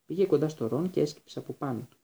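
ordinary delivery, nothing marked

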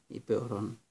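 usual speaking voice

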